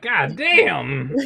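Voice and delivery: loud, high exclamation with a laugh; exasperated reaction. Retold or imitated. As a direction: exclaim it in amused disbelief. Listener reacts to overlong joke.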